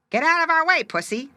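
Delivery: high, loud squeaky little voices; sharp high squeak; squeaky crabs. Acted. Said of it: "they squeaked".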